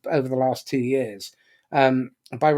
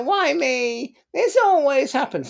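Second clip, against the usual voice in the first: high, drawn-out whine of self-pity; whiny victim voice; 13 semitones higher, slower than usual. Retold or imitated. Speaker mocking the victim in the drama triangle, high whine. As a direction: play the helpless victim, whining. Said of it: "The victim's voice sounds like".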